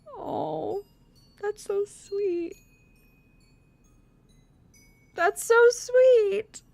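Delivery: high, slow, whiny crying coo; tearful gushing. Real, not acted. Player moved by the love scene, tearful gushing. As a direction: draw it out, tearfully touched.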